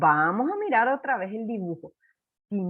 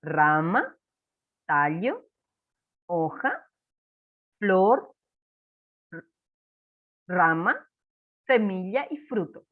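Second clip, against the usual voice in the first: each word alone, rising at its end, with pauses; teacher leading a repeat-after-me list; call and repeat; 4 semitones lower, slower than usual. Shown as a demonstration. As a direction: model each plant part for children to echo. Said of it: "Repetí conmigo".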